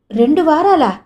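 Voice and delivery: high, loud startled gasp of a question; jolted by the news, blurting it back. A narrator's reading. Jayanti hearing she slept two weeks, startled disbelief. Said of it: "జయంతి ఉలిక్కి పడింది".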